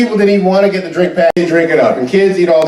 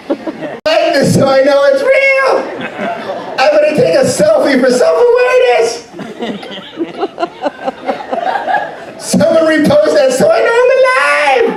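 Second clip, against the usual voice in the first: mock selfie poster; 12 semitones higher than usual. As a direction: parody someone living online. Mocking selfie-obsessed people.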